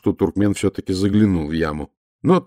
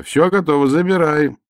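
high, louder call; calling the sentry from the pit; shout; 7 semitones higher, 3 dB louder than usual. Acted. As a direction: keep yelling it up to him. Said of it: "крикнул я".

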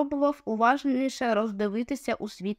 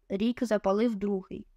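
usual speaking voice

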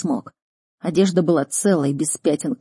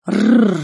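short, loud rolled growl; raised-pitch animal growl; renewed beast growl; 5 semitones higher, 6 dB louder than usual. Acted. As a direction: snarl like a big cat. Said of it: "вновь зарычал Никс".

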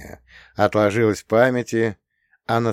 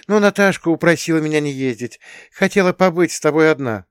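Rita's voice; 8 semitones higher, 5 dB louder than usual. A narrator's reading. Rita, voiced higher.

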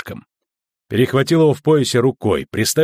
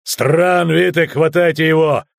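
raised, higher-pitched command; urgent shouted order; 5 semitones higher, 3 dB louder, slower than usual. A narrator's reading. Guard ordering henchmen to seize him, urgent. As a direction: call your men into action.

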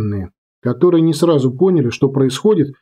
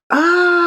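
burning man's scream. Acted. Sailor set on fire.

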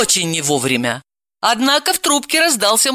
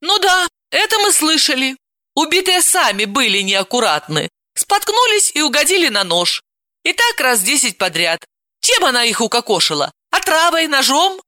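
higher, mocking sarcasm; sarcastic; 8 semitones higher than usual. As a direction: scoff at his story. Aunt mocks.

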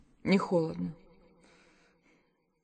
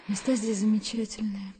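ordinary talk, nothing marked

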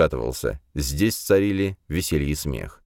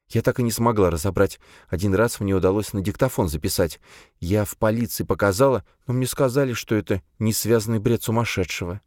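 grieving mother voice; 4 semitones higher than usual. Acted. Mother recounts police visit.

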